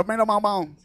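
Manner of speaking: twangy rubber-band voice, imitated; mock Texan, words bouncing like a rubber band; Ross Perot. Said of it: "he sounds like a rubber band".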